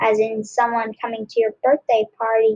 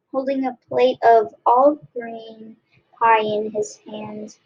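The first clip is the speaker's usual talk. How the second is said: plain reading voice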